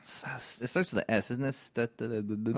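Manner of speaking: low, quiet mumble while searching memory; quiet muttering. Real, not acted. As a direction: mutter it to yourself. Trying to recall a word.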